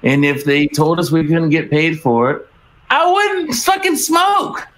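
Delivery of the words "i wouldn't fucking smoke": high, slow, yelled refusal; defiant shout. Retold or imitated. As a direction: yell it out defiantly.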